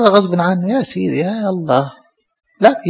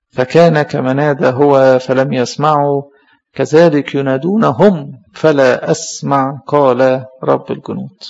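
slow, solemn reading of a verse; scripture reading; 3 semitones lower, slower than usual. Acted. Priest reading scripture to the congregation, slow and solemn. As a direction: read the prophet's words slowly and gravely. Scripture read slowly in sermon.

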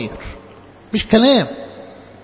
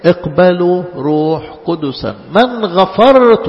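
slow emphatic; 4 dB louder, slower than usual. Acted.

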